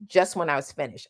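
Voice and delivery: ordinary talk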